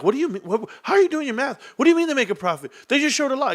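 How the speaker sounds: high, incredulous imitation of his debate opponents; mocking the doubters. Skeptical debaters questioning him.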